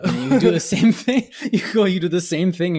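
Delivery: laughs high and loud mid-sentence; laughing through the point. Real, not acted. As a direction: burst out laughing.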